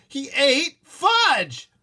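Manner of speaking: high, loud, very slow insistence; insisting. Acted. Boy insisting to his mother, loud. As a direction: insist with all your force. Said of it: "I insisted".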